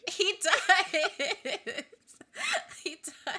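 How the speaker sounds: squeaky laughing agreement; real laughter. Real, not acted. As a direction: burst out laughing as you agree. Agreeing, cracking up.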